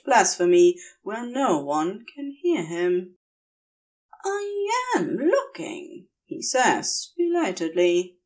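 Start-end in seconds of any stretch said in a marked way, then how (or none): 4.3-6.1 higher, slow, dreamy angel voice; a drowsy, befuddled angel answering softly; dazed Aziraphale. Acted.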